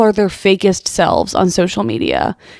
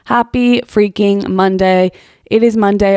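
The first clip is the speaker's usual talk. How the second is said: ordinary talk, nothing marked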